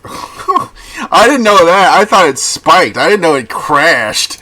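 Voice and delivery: high, loud talk through real laughter; laughing. Real, not acted. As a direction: crack up while saying it. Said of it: "I shouldn't be laughing".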